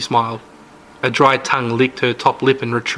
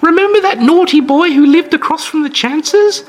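put-on high, chirpy old-lady voice; sweet old lady; 5 dB louder than usual. Old hostess brags about her jam, sweet high voice. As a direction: play the sugary granny host, all charm. Mrs Anders introduces Blake.

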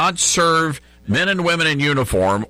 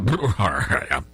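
stifled laugh; 7 semitones lower, 3 dB quieter than usual. Real, not acted. Laughing at quote.

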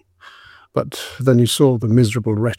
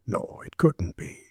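whispered thought; 3 semitones lower, 10 dB quieter than usual. A narrator's reading. Constable's doubting inner thought.

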